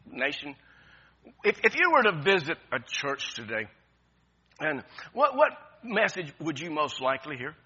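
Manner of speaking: normal talking voice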